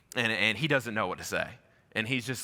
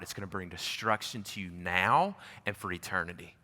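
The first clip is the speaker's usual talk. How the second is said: tearful plea; 5 semitones lower, 5 dB quieter than usual. Retold or imitated. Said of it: "tears started to fill my eyes".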